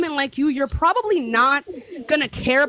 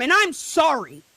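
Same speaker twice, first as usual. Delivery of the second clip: ordinary talk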